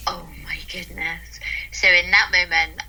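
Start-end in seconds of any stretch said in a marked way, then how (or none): 0.1-1.4 soft amazed sigh of words; hushed awe. Retold or imitated. Owner touched by rescue dog's first contact, hushed awe. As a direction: breathe it out, much softer, in awe. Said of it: "And I was just like".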